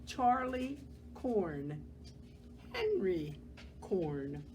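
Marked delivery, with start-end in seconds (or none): none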